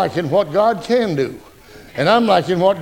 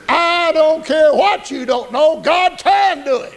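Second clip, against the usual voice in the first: slow, raised preacher's cry; preaching shout; 8 semitones higher, slower than usual. Real, not acted. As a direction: shout it to the church. Preacher builds to a climax.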